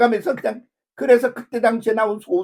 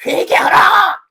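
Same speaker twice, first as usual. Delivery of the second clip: much higher, loud call to repent; revival shout; 10 semitones higher, 7 dB louder, slower than usual. Retold or imitated. Preacher imitating revival meetings, shouting repent. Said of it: "모여서 뭐 집회도 하고 그러면".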